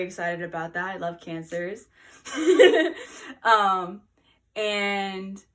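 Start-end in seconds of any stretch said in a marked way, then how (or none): 2.3-3.4 a burst of loud laughter. Real, not acted. Laughing at own joke.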